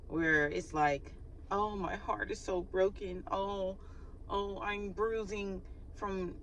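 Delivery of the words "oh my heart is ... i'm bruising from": slow, soft, overdone moaning; milk the hurt like a hypochondriac, softly; mock a drama queen's soft wail; whiny hypochondriac. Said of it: "what do you call them people? A hypochondriac?".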